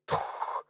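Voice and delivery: very quiet, voiceless puff of air; muffled thump. A narrator's reading. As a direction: imitate a dull shot with breath alone. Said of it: "Раздался глухой". (глухой выдох, имитация выстрела)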